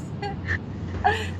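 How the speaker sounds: soft, very high-pitched giggle; fangirl giggle. Real, not acted. Fan embarrassed by own gushing, high soft giggle. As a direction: let out a tiny high squeaky laugh. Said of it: "I'm fangirling a little bit".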